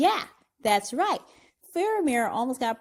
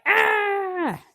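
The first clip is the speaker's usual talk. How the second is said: exasperated cry; 10 semitones higher, 4 dB louder than usual. Retold or imitated. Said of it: "I'm like".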